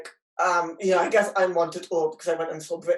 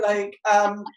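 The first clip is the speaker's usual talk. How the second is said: ordinary talk, nothing marked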